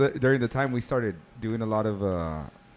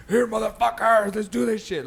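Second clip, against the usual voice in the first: high, loud, slow DJ hype; hyped radio DJ; 10 semitones higher, 5 dB louder than usual. Retold or imitated. DJ hyping up his drunk friends before a song, high slow hype. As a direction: pump up the crowd like a late-night DJ.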